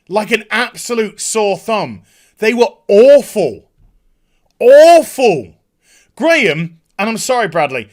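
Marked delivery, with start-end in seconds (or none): 2.4-5.5 louder, higher outraged verdict; ranting reviewer's loud disgust. Real, not acted. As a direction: slam the word awful. Ranting about bad actors.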